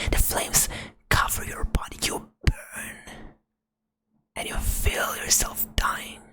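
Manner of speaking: ordinary delivery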